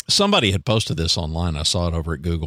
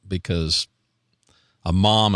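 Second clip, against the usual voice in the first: ordinary talk, nothing marked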